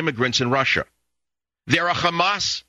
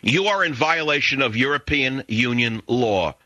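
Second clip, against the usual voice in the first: ordinary talk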